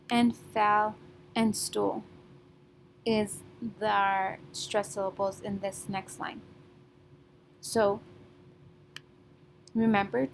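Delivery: normal talking voice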